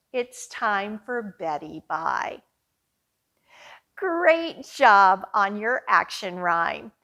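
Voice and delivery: ordinary talk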